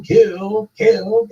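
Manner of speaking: high-pitched frenzied yelp; mock yelling. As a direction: chant it high like a gung-ho madman.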